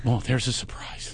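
soft, deadpan sarcastic aside; understated sarcastic aside; dry sarcasm. Retold or imitated. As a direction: mutter it sarcastically. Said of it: "I'm going".

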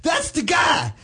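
hammed-up shout from the valet; mock shout. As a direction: call it out across the lobby like the groomsmen. Groomsmen shouting across the valet.

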